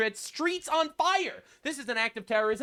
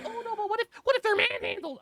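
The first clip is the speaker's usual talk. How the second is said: much higher falsetto voice of a hand-wringing critic; mocking whiny critic; 13 semitones higher than usual. Mimicking an imagined critic, high whiny falsetto. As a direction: squeak it like a fretting do-gooder.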